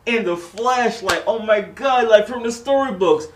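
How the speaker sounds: high, loud awestruck squeal; starstruck gush. Retold or imitated. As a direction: gush like a fan meeting a hero. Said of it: "And Blake was like".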